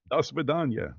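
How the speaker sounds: put-on playful Russian farewell in accent. Answering interviewer's vodka joke, playful Russian accent. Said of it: "I do good Russian accent".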